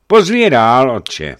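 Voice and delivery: high, loud order falling away at the end; commanding. A narrator's reading. Archbishop ordering the monk to admit messengers, commanding. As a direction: issue the order with force. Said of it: "přikázal Eufemii".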